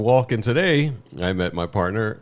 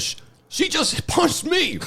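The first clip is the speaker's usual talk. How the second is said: very high, slow aggrieved woman voice; older woman imitation; slower than usual. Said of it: "there was a woman probably mid sixties".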